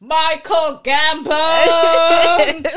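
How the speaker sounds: loud, slow and drawn out; announcer shout. Pretend. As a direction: boom it like a ring announcer. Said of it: "move away from the microphone so that I can do it properly".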